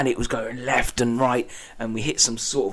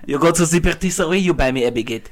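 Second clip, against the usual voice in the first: mock French; 3 semitones higher, 5 dB louder, faster than usual. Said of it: "mock French customer demands".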